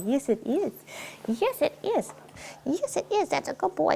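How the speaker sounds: high, rising pet-talk praise. Real, not acted. Owner praising her dog, high baby talk. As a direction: coo over the puppy.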